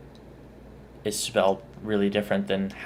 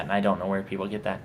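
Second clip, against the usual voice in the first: normal talking voice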